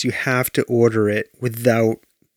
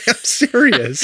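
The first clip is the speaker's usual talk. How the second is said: giggling through words; laughing; 11 semitones higher than usual. Real, not acted. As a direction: laugh while saying it. Laughing after mistake.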